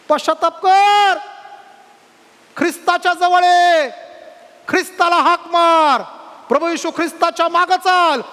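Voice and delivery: building to high, loud exhortation; full-force preacher's call to repent; urgent preaching. Real, not acted. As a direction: cry out each appeal to the congregation.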